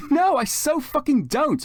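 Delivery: flustered goth denying her crush at full volume; shouted denial. Acted. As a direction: yell it like a flustered teen. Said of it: "I shouted".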